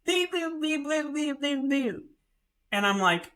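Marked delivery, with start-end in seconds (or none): 0.0-2.0 high chirpy mock beeps in a row; imitated beeping. Teller mimics the kiosk beeping at him, high mock beeps. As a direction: chirp it like a Redbox machine. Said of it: "and I hear this".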